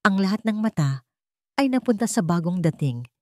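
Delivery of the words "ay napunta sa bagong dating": plain reading voice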